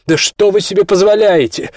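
loud, high indignant cry; outraged protest. A narrator's reading. Olya as police drag Kostya away, outraged. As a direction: protest at full pitch, near despair. Woman protests as husband is seized.

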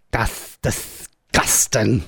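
slow, drawn-out Norfolk "That's disgusting"; Norfolk accent. Shown as a demonstration. Host demonstrating the Norfolk way to a caller, broad Norfolk. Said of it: "a proper Norfolk way to say it".

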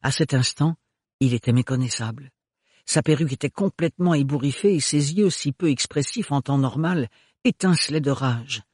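ordinary delivery

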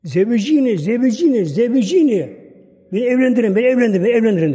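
high, loud pleading outcry from a startled sleeper; desperate cry. Acted. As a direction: beg them aloud to marry you off, urgently. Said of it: "şöyle diyor".

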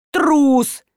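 high, drawn cry; exclaimed accusation. A narrator's reading. Two grannies shaming the old man, exclaimed accusation. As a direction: exclaim it with scorn. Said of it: "воскликнули теперь две бабули".

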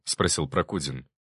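ordinary delivery, nothing marked

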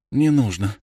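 ordinary delivery, nothing marked

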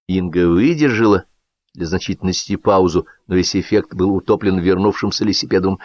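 usual speaking voice

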